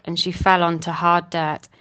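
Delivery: usual speaking voice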